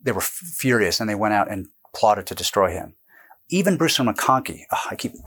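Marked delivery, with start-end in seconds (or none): 4.7-5.1 quick quiet aside. Real, not acted. Kicked the camera again.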